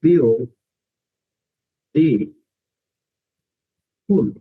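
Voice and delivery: বিও, দি, ফুল with long pauses between; syllable by syllable. Shown as a demonstration. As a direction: break it into three beats: বিও, দি, ফুল. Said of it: "এটার উচ্চারণ হবে".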